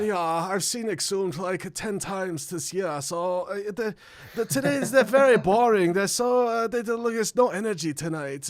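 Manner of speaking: put-on German accent. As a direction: imitate a bored German metal fan. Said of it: "perfect German accent".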